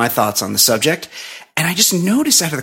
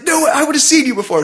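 high-pitched, argumentative retort; mild mock scream; 13 semitones higher, 4 dB louder, faster than usual. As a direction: imitate a wife yelling back, not full volume. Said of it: "starts screaming at".

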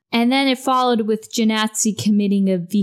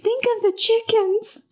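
higher, slow, hammed-up plea; mock dramatic plea; 13 semitones higher than usual. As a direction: wail it like a soap-opera mother. Echoes catchphrase, slower, higher.

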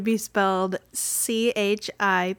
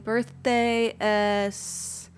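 usual speaking voice

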